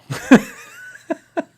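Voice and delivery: helpless high, breathy chuckling; real laughing fit. Real, not acted. Host amused by saying it out loud, laughing. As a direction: crack up at your own remark. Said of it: "I don't know why I'm laughing".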